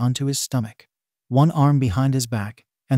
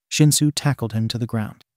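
ordinary delivery

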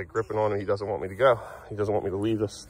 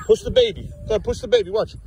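high, lively encouragement; playful urging; 8 semitones higher, 3 dB louder than usual. Real, not acted. Parent eggs child on in play.

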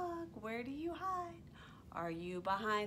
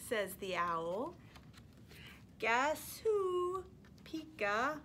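lively, sing-song storytime reading; animated picture-book read-aloud; reading to toddlers; 3 semitones higher, slower than usual. Acted. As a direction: read it like you're holding the book up for little ones.

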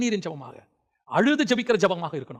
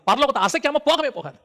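usual speaking voice

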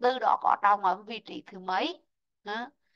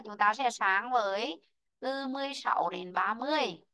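ordinary talk, nothing marked

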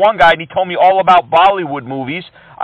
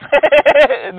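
high, amused laugh; 8 semitones higher than usual. Real, not acted. Scam baiter hearing scammer hate India, amused laugh. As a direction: laugh in disbelief at the claim.

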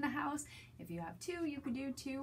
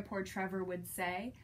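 usual speaking voice

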